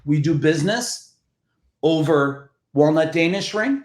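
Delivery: normal talking voice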